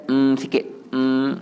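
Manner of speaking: short hummed "mm"; closed-mouth mim. Shown as a demonstration. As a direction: hold "mm" briefly with lips shut. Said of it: "sampai tutup mulut dan".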